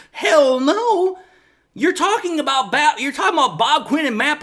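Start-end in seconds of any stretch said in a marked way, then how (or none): 0.1-1.2 emphatic hell no. Retold or imitated. Emphatic rhetorical answer.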